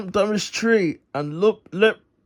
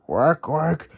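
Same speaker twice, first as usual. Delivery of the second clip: unhappy duck squawks; 3 semitones lower than usual. Acted. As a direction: quack quack in a duck voice. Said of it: "squawked an unhappy mother duck".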